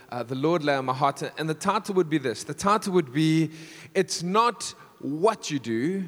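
normal talking voice

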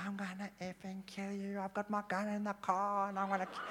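imitated enraged threat. Angry surfer threatening the man who took his wave, mock rage. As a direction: imitate someone losing it, without shouting. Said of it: "he just lost it".

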